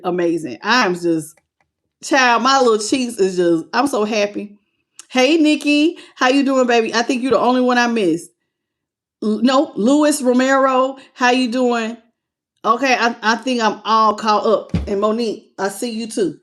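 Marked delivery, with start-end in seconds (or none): none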